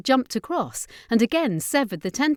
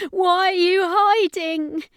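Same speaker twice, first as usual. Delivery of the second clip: slow, much higher and louder challenge; shouting; 12 semitones higher, 7 dB louder, slower than usual. Acted. Ashley challenges her hidden brother, slow loud shout. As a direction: shout it into the darkness, holding your ground. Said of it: "Ashley shouted".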